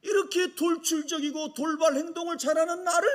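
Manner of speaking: usual speaking voice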